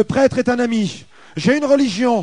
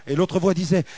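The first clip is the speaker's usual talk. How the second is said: ordinary talk, nothing marked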